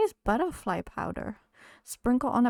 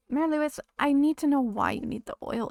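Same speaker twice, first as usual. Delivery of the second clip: higher, louder mock interrogation; mock demanding; 5 semitones higher, 5 dB louder than usual. Player teasingly confronting Mayor Lewis, mock demanding. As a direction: demand an answer, playfully accusing.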